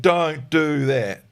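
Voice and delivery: slow, drawn-out "don't do that"; telling off a dog that already obeyed; nagging scold. Retold or imitated. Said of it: "and now you're going".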